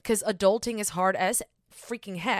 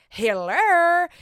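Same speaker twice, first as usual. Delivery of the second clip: loud high Hello to the whole world; calling out; 9 semitones higher, 9 dB louder than usual. Pretend. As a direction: announce yourself to the world.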